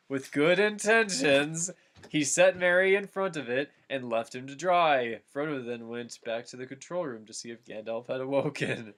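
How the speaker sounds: ordinary delivery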